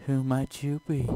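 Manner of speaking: lightly voiced demoness asking coyly; succubus voice. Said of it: "that's the voice".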